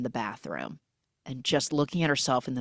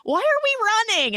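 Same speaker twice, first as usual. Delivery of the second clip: high silly voice; 5 dB louder than usual. Retold or imitated. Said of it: "is like".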